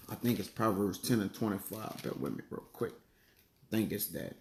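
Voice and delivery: low, soft mutter under the breath while searching; half-voiced aside to himself, slowing down; muttering to self. Real, not acted.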